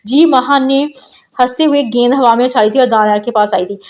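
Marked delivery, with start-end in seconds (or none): none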